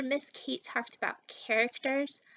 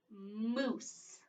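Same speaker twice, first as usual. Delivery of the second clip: slow clear word; 2 semitones lower, 7 dB quieter than usual. Shown as a demonstration. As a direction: say the game word slowly and clearly. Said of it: "are you ready to play".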